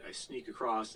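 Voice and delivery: ordinary talk, nothing marked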